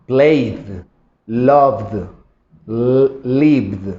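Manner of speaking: Played, loved, lived with a soft D ending; English D ending, no extra vowel. Shown as a demonstration. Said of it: "agregarle fonéticamente una D".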